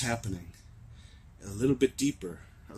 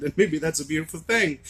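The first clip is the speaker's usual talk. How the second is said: ordinary talk, nothing marked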